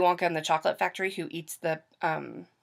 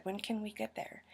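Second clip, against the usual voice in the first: very soft, acted hushed question; hushed retold aside; 2 semitones higher, 15 dB quieter than usual. Asking mom with phone covered, hushed aside. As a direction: drop right down as if covering the phone. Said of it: "I covered up the phone".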